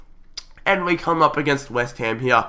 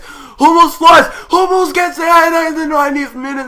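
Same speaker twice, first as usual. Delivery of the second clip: screaming, much higher and louder; goal shout; 8 dB louder than usual. Real, not acted. Gamer screaming at his team's late equaliser.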